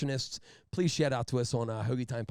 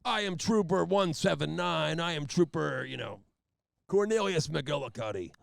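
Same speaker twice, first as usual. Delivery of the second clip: pompous trooper; 3 semitones higher, 3 dB louder than usual. Retold or imitated. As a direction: announce yourself like a big shot. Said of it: "starts it off with".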